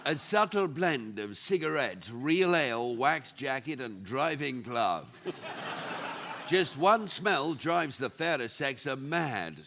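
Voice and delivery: sultry perfume ad. Acted. Perfume ad voiceover.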